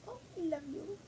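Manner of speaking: high, hushed and loving; high soft coo. Real, not acted. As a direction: coo it to the little dog. Cooing to dog.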